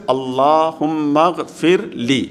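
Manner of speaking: very slow teaching recitation. Shown as a demonstration. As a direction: draw out each syllable of the supplication. Teacher repeats short supplication. (کھینچ کر آہستہ دعا پڑھنا)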